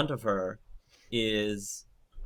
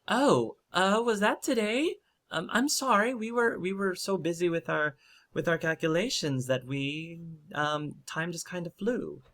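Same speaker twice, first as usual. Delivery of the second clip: high, hesitant character voice; Dagna, apologetic; 9 semitones higher than usual. Acted. Dagna excusing herself to the golems, high and flustered. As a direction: stammer out a high-pitched excuse.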